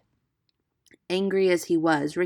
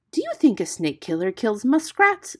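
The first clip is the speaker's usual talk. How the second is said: raised, louder scornful question; 5 semitones higher, 7 dB louder than usual. A narrator's reading. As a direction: scoff at a silly fear. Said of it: "said Rikki Tikki scornfully".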